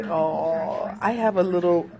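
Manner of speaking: normal talking voice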